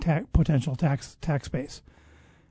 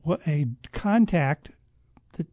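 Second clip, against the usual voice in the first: usual speaking voice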